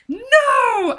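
high shrieking no; hammed-up anguished no; mock anguished cry. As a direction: wail it out like a heartbroken heroine. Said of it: "And then she's like".

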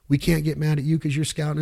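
ordinary talk

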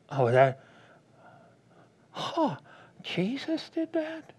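high, soft, slow put-on voice of a shocked listener; mock shocked listener. Preacher playing a listener shocked by Jesus, soft and high. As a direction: gasp it out like a scandalised churchgoer. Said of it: "mock shocked church member".

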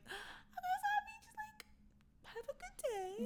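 timid reply. Retold or imitated. Friend retells meeting livid friend, high nervous squeak. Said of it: "And I was like".

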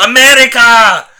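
put-on loud, high, drawn-out Russian-accented shout; mock Russian accent, shouted with gusto; Russian-accented cry. Retelling the character landing in America, exclaimed in a Russian accent. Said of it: "supposed to be like a Russian character".

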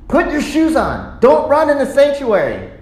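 high, sharp telling-off; uptight parent scolding. Retold or imitated. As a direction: scold the kids in alarm. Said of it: "It tightened up as like".

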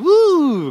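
put-on high, loud childish woo; excited toddler woo. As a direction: whoop like a thrilled little girl. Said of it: "she'd always go".

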